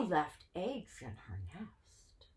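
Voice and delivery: whispered chant line; a soft whispered rhyme; whispered verse. Acted. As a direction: hush it like a secret.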